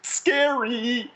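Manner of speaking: high, drawn-out mock spooky voice; high-pitched pretend spookiness; scary voice. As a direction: put on a playful scary voice. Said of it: "That's my scary voice, by the way".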